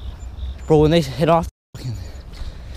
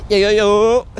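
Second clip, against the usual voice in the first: higher, louder hype call; hyped; 8 semitones higher, 4 dB louder, much slower than usual. Real, not acted. Celebrating the catch with friends, hyped. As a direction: pump the friends up over the fish.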